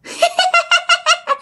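very high-pitched imitated child giggle; high, staccato mischievous titter; gleeful child's giggle. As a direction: mimic a ghostly kid giggling with delight. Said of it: "Như là con nít mà nó cười khoái chí".

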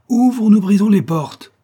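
very high, loud threat; threatening shout. A narrator's reading. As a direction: bellow an ultimatum through the door. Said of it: "s'écria Cavaret".

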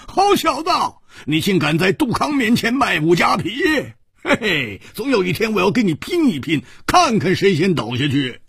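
high mock-indignant cry; indignant outcry. A narrator's reading. Hu Tiehua challenging his friend to drink, mock-indignant cry. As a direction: challenge him to a drinking duel, playfully. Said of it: "胡铁花叫起来说".

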